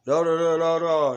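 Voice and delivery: hammed-up announcing voice; mock booming voice.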